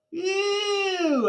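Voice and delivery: high shouted disgusted ew. A narrator's reading. Boy sees officer kiss teacher, disgusted high squeal.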